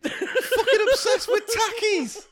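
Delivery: very high, drawn-out squawk; exasperated squawk. Real, not acted. Co-host reacting to the snack obsession, high exasperated squawk. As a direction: screech it slowly, half laughing. Exasperated outburst after the played clip.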